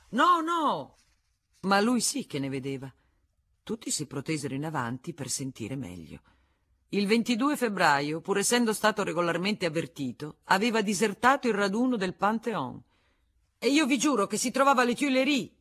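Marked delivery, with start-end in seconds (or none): 0.1-1.0 high, loud chorus of protest; crowd shouting no. Acted. Club members shouting down the objection, high and loud. 6.9-12.8 raised, accusing; stern accusation. Acted. Senecal accusing Federico before the club, loud and stern. 13.6-15.6 high, loud and fast protest; exclaiming. Acted. Dussardier defending Federico to the club, high, loud and fast. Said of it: "esclamò Dussardier".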